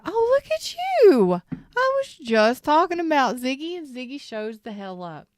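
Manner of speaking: gushing over a friend who just showed up; delighted surprise. Real, not acted. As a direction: greet a surprise arrival with a thrilled squeal. Ziggy suddenly joins the chat.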